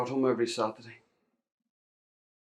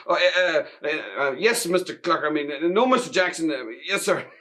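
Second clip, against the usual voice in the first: flustered stammer, higher and louder; startled stammering; 7 semitones higher, 7 dB louder than usual. Acted. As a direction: trip over every word, caught out. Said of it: "I started stammering and stuttering".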